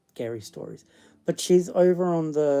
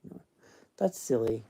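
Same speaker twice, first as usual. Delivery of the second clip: ordinary talk, nothing marked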